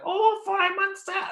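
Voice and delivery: put-on very high, slow whiny kid voice; imitating Norman Price. Dad mocking the annoying cartoon kid, high whiny voice. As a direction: whine it out like an annoying cartoon boy. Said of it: "he's so annoying that".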